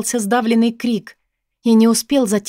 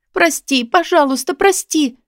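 high, acted tearful begging; sobbing cry; 8 semitones higher, 3 dB louder than usual. A narrator's reading. Woman crying out to the man who punishes her, sobbing. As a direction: beg forgiveness as if choking on sobs. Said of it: "закричала я, давясь рыданиями".

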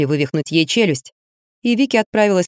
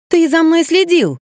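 high, slow, startled outburst; startled accusation; 11 semitones higher, 4 dB louder, slower than usual. Acted. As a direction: catch him out in alarm.

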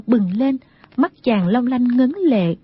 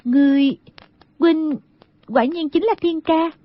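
halting, higher-pitched question; trembling voice; 5 semitones higher than usual. Acted. Girl approaching her long-lost love, hesitant and trembling. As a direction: falter between words, voice lifted. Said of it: "hỏi bằng một giọng run run".

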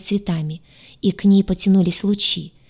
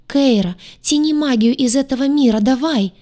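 raised, higher voice of alarm; frightened cry; 6 semitones higher, 3 dB louder than usual. A narrator's reading. Гадюша urges Кейра to pull magic, frightened cry. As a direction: urge her on in a panicked call. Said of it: "перепуганный крик Гадюши".

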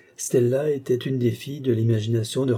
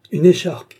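much higher, much louder: "Une écharpe" flung out with stress on "Une" and a falling end; violent interruption; 7 semitones higher, 9 dB louder than usual. A narrator's reading. Baker interrupting Raphaël, a loud outraged outburst. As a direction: cut him off, outraged. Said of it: "interrompit violemment le Fornaro".

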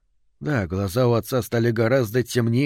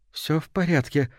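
higher voice with a nervous tremble; trembling, anxious question; 5 semitones higher than usual. A narrator's reading. Wife anxiously asks her returned husband, trembling voice. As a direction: ask it high and uneasily. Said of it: "В голосе её послышалась дрожащая нота".

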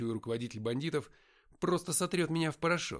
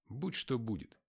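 ordinary delivery, nothing marked

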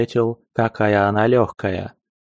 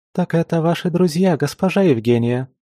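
high, bright chirpy maid voice; chirping; 6 semitones higher than usual. Acted. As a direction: chirp it like a cheerful little bird. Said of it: "прощебетала незнакомая девушка".

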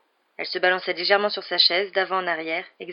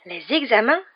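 high, louder startled question; surprised exclaim; 5 semitones higher, 6 dB louder than usual. A narrator's reading. Seamus reacting to the exam news, surprised. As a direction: blurt it out in disbelief. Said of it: "s'exclama Seamus Finnegan".

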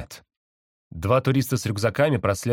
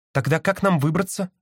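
high worried voice; sister voice; 8 semitones higher than usual. A narrator's reading. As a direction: ask it high and anxious. Sister asks.

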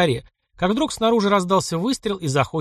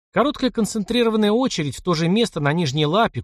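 usual speaking voice

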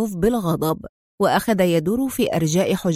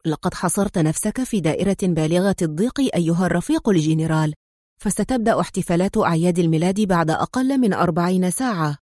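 ordinary delivery

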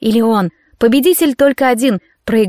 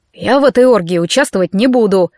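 ordinary delivery, nothing marked

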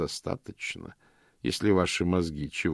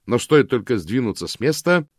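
feigned enthusiasm; 5 semitones higher, 6 dB louder than usual. A narrator's reading. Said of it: "заметил я с воодушевлением".

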